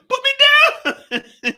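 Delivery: very high, slow squealed put me down; mock squeal. Acting out Cassie held up in the air, squealing. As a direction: play the victim dangling in the air.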